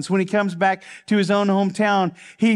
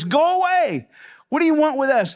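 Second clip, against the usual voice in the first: high-pitched demonic cry; 8 semitones higher, 3 dB louder than usual. Retold or imitated. Possessed man confronts Jesus in the synagogue, crying out. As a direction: cry out high, a little louder. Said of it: "cried out at the top of his voice".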